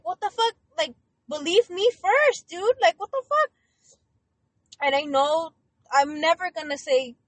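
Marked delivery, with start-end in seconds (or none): 0.0-3.5 higher and louder, outraged disbelief; exasperated outburst. Retold or imitated. Reacting to family who won't believe her, outraged and much higher. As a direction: blurt it out, climbing in pitch. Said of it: "And it's just like".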